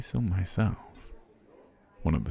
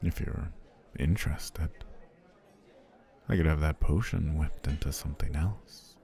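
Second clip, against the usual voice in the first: ordinary talk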